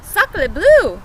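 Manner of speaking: high, loud mock-French exclamation; excited cry. Duck waddling by sees the nest, cries out high and loud. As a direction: burst out like an astonished duck. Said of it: "she cried".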